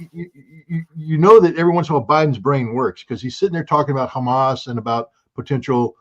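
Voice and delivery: ordinary talk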